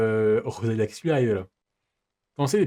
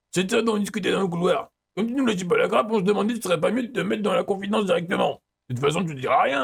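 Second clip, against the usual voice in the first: voix de personnage légèrement composée; garçon du jeu qui se confie; 5 semitones higher than usual. Acted. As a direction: livre ton secret de musicien, doublage léger. (voix jouée de personnage de jeu)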